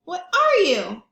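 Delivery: high loud cry; elephant crying out again. Acted. Elephant keeps crying out at the thingamabob. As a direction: call out in frustration. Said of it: "he cried".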